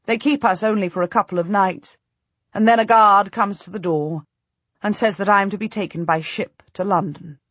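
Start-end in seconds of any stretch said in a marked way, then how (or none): none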